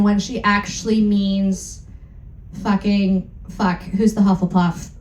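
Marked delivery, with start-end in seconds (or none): none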